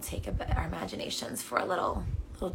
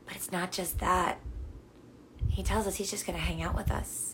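normal talking voice

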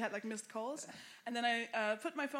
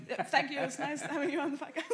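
mock polite host; 4 semitones higher, 6 dB louder than usual. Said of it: "and you go".

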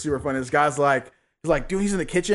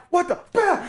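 startled mom; 10 semitones higher than usual. Retold or imitated. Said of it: "his mom jumps back".

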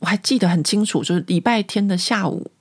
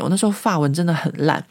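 normal talking voice

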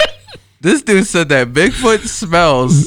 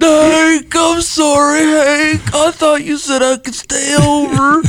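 much higher, whiny sad-sack voice; Bill Dauterive impression; 12 semitones higher, slower than usual. Quoting King of the Hill, whiny apology to Hank. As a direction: plead like the pitiful neighbor Bill. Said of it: "Poor, poor Daughtry".

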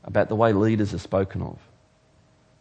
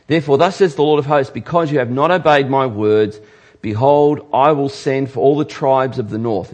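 prophetic reading; 4 semitones higher, 6 dB louder than usual. Acted.

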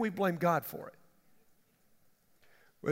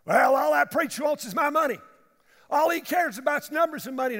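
louder, higher complaint; griping member; 7 semitones higher, 7 dB louder than usual. Retold or imitated. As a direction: gripe about the preacher.

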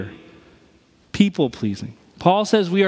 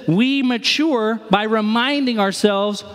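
slowed, weighty reading of the line; slow, deliberate scripture-style delivery; slow emphatic quote; 3 semitones higher, slower than usual. Shown as a demonstration. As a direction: lay each word down slowly. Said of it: "He says this".

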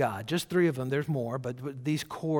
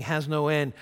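usual speaking voice

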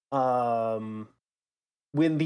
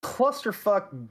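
clusterfuck stretched slow and high; drawn-out emphasis; 7 semitones higher, much slower than usual. Acted. Echoing the word, drawn out.

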